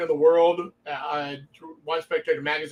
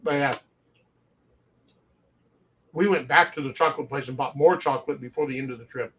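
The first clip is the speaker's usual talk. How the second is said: ordinary delivery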